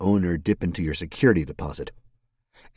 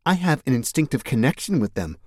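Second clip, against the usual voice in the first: put-on playful young woman; 8 semitones higher than usual.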